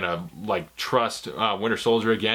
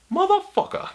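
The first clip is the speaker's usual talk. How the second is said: mock British ghost swearing, pitched way up; British accent. The dead girlfriend's ghost cursing Cap in a mock British accent. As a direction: squeal it in a silly posh British accent. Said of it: "'cause she's British".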